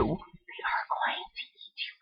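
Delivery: soft, breathy mock-scary whisper; spooky whisper. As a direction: whisper it like a ghost story. Spooky repeat of the threat.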